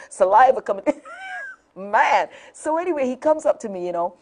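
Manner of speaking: normal talking voice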